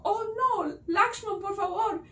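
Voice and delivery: high-pitched storybook worry; anxious plea. A narrator's reading. Sita begs Lakshman to save Rama, anxious. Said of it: "Sita se puso muy, ah, eh, ansiosa".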